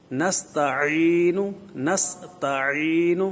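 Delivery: slow, loud نستعین with a clear throaty ain; ain drill. Shown as a demonstration. Teacher drilling students on the correct ain, slow. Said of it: "عین کی آواز نکالنے کی کوشش کرنی ہے".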